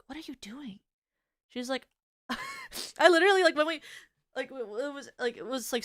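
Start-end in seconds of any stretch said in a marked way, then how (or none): 0.1-0.8 unvoiced shocked whisper. Whispering to her friend, shocked at her talking back. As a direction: breathe it out in alarm, no voice. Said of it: "I was like".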